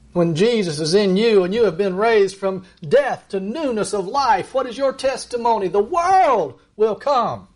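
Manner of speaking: high, ringing pulpit voice, slowing to a heavy close; impassioned sermon reading; fervent preaching. Acted. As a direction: preach it out to the congregation with rising fervour. Preaching John twelve aloud.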